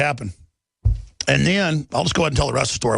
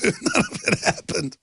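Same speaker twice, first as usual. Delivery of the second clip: laughing retraction; 4 semitones higher than usual. Real, not acted.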